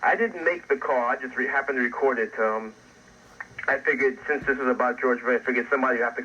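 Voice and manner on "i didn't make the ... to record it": ordinary talk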